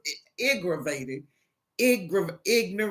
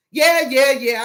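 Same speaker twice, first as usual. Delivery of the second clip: loud, high, rapid yeahs; impatient brush-off; 5 semitones higher, 6 dB louder than usual. Acted. Agent to a complaining caller, impatient brush-off. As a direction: cut the caller off dismissively.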